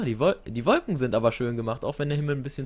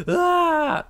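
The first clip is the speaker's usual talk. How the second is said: sudden high loud yelp; startled yelp; 6 dB louder than usual. Real, not acted. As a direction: yelp as the fire hits. Character catches fire.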